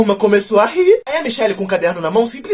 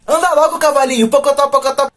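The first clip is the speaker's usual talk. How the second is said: bossy friend; 5 semitones higher than usual. Retold or imitated. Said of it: "falou assim".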